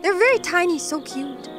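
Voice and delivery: ordinary talk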